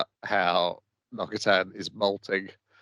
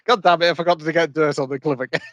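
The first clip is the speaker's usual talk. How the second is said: quick, higher-pitched frustrated outburst; mock exasperation; 5 semitones higher, 4 dB louder, faster than usual. Girl wandering home from the deer club, mock exasperation. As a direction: rush it out, kicking yourself. Character remembers her forgotten mission.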